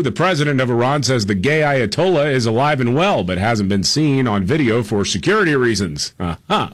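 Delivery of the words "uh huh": usual speaking voice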